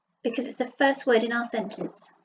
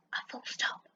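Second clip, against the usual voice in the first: whisper; 14 dB quieter than usual. Real, not acted. Teacher reveals the ending.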